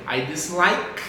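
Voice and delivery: "dislike" ending on a K sound; silent final E. Shown as a demonstration. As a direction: say "dislike" with no vowel after the K. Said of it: "a gente não pronuncia".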